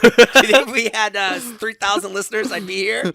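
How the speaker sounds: high, loud mock-bragging; hyped boast. Bragging to David about listeners, high and loud. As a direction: pump up the crowd like a hype man.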